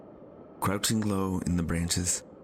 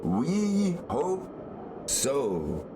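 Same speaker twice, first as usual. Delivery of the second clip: distant reply; 8 semitones higher, much slower than usual. Acted. Aeronauts call back distantly.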